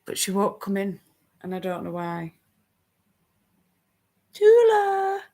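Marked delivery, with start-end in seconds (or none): 4.3-5.2 calling the cat. Real, not acted.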